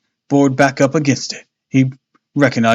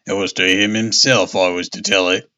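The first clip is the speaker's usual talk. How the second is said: seafarer voice; 6 semitones lower than usual. Acted. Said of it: "Guess we're using it here".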